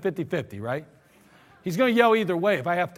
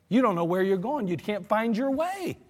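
ordinary talk

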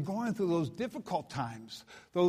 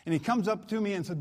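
ordinary talk, nothing marked

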